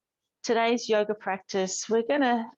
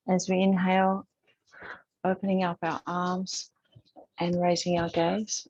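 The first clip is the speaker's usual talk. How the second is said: calm, unhurried instructor voice with long pauses; even, measured cues, leaving space to breathe; guided yoga meditation; 3 semitones lower than usual. Real, not acted. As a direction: gently guide a class through breath and movement. Leads breathing and gentle yoga.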